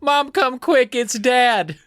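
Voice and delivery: put-on high, urgent kid calling out; calling for mom. Kat calls mom after dad falls, high and urgent. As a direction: yell for help like a scared child. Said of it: "she's like".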